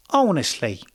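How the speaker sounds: playful attempt at British English; British accent. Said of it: "That's my British accent".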